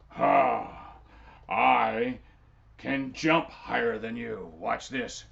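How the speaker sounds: very loud, slow giant boast. Acted. Giant showing off his jump to Jenny, loud. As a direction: crow it out. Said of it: "The giant said".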